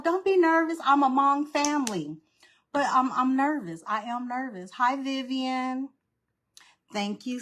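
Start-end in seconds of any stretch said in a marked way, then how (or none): 0.0-2.2 raised, louder pep talk to herself; self pep talk. Retold or imitated. Host reassuring herself before a live craft session, raised pep talk. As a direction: coach yourself, higher and louder. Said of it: "I tell myself".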